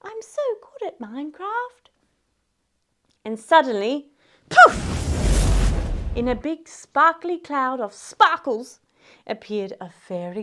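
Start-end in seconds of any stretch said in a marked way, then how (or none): 0.0-1.9 high, soft, self-pitying Cinderella; whispered complaint. Acted. Left-out Cinderella laments missing the ball, high and soft. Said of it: "she whispered, wiping snot". 4.5-6.2 high burst of breath; explosive puff for a magic entrance; magical poof. Acted. Storyteller makes the fairy godmother appear, bursting poof.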